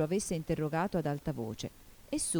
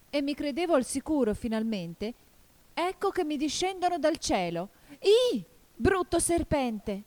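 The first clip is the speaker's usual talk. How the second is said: desperate cry; 10 semitones higher, 4 dB louder than usual. A narrator's reading. Pigeon sees snakes dropping from the sky, desperate cry. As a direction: despair climbing to a high shriek. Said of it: "continuò il colombo con un grido disperato".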